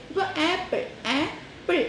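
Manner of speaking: ആപ്പിൾ lifted as ആപ്-പിൾ, teacher showing the beats; syllable demo. Shown as a demonstration. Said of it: "രണ്ട് syllable". (ആപ്പിൾ രണ്ട് സിലബിളായി പിരിച്ച് ഉയർന്ന ശബ്ദത്തിൽ)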